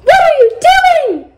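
high-pitched mock scream. A narrator's reading. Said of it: "screamed Aqua".

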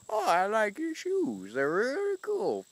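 put-on high, slow sweet old lady. Old lady at Walmart praising the Heelys, high and slow. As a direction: lightly play a sweet old lady admiring shoes. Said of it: "there's this old lady who's like".

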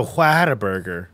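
Whataburger with a breathy "hw" and flat "a" as in hat; mocked pronunciation. Mocking co-host going to the burger place, exaggerated Whataburger. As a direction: overdo the first syllable of Whataburger as "hwat". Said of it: "put the equalizer on it and make it".

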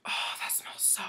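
breathy hushed delight; soft whispered pleasure; awed whisper. Real, not acted. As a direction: whisper it savoring the scent. Sniffing shampoo.

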